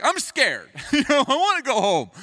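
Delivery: high, whiny fright; mock scared Gideon. Gideon admitting he wants to go home, mock-frightened high voice. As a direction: play him as a scared kid.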